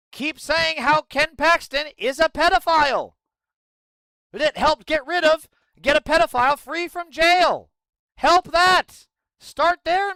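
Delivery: loud, high angry ranting; furious shout. Real, not acted. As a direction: yell it at the party, fed up. Angry rant at Democrats.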